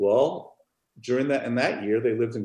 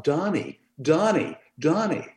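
retold yell; 6 semitones higher, slower than usual. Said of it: "patient yelled out".

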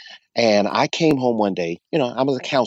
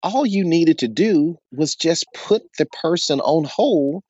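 higher, impatient lecturing tone; upset tone; 8 semitones higher than usual. Retold or imitated. Retelling how he scolded his tired wife, in a higher, impatient tone. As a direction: talk down to her like she missed the obvious. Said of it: "In that kind of tone, it was all like I was upset".

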